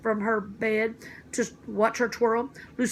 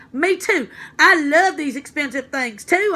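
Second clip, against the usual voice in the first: eager agreement; 4 semitones higher, 7 dB louder than usual. Acted. Junie excitedly agrees with Lucille.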